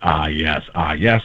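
very deep, dropped-down voice; Morgan Freeman. Shown as a demonstration. Showing how the impression starts, deep voice. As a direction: say it as low as you can go. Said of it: "drop your voice as much as you can".

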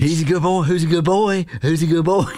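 lightly put-on baby talk to a dog; baby talk to pets. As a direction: coo at the dog in a mild baby voice. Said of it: "you do the baby voice or whatever".

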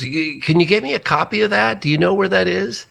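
mock the caller in a high put-on voice; imitating a friend. Friend asks for a copy of the show, imitated high. Friend's phone call retold.

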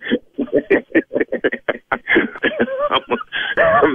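long, high helpless laughter; big laugh. Real, not acted. Singer laughing after learning the call was a prank, cracking up. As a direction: burst out laughing in relief.

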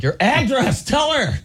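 high, urgent wife voice; mimicking wife. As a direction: hiss it up high like a nudging wife. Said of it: "my wife's like".

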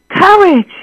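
plain reading voice

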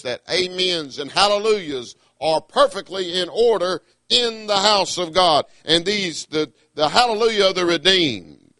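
normal talking voice

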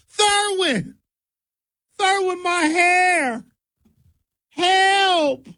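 very high, slow, strained lady wail; hammed-up falsetto panic over her lost hair; panicked auntie falsetto. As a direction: cry out in a high voice as your wig flies off. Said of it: "Why does she have a speech impediment?".

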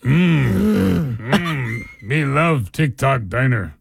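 slow, grunting mock movie chief; old Western chief. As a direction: play a caricature Western chief, grunts between slow words. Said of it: "Do your Indian chief voice".

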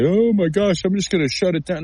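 hammed-up tearful giving up; mock crying. Analyst mimics a lineman crying about the trade, mock crying. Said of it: "sit there and cry about it".

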